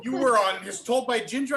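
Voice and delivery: high, drawn-out, gushing disbelief; starstruck. Retold or imitated. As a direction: gush like an amazed fan. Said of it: "she was so starstruck".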